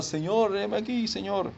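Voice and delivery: high, soft put-on answer; movie-style awe. Preacher acting a trembling servant answering God, high hushed voice. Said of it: "y todo tiembla".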